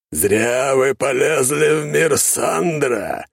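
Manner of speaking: inhuman, slow, booming voice; eldritch horror. A narrator's reading. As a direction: inhuman demon taunting slowly. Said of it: "снова сказала фигура".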